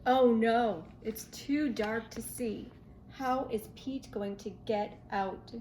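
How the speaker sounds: plain reading voice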